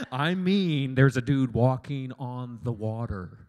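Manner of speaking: loud and slow, insisting; freaked-out disciple. Retold or imitated. Disciple insisting to his friend in the boat, loud and slow. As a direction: spell it out, half panicked.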